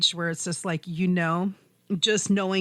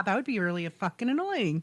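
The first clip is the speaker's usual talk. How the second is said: usual speaking voice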